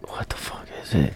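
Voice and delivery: whispered under the breath; whispering while trying to remember; 1 whisper to self. Real, not acted. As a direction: mutter it to yourself, voiceless. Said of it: "trying to recall a title".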